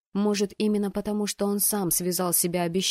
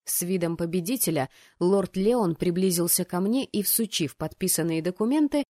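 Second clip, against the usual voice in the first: ordinary delivery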